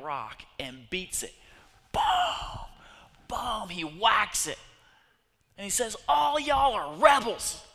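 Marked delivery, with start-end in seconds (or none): 1.9-3.7 loud booming strike sounds; imitated whacks. Pastor imitating Moses striking the rock, loud booms. 6.1-7.6 loud, high, slow furious yell; angry shout. Acted. Moses yelling at the complaining people, furious. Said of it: "he's hit with anger".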